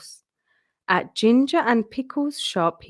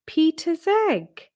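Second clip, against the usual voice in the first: much higher, drawn-out gleeful cry; joyful surprise; 8 semitones higher, slower than usual. Acted. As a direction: present the gift with a happy high cheer. Triplets reveal his egg.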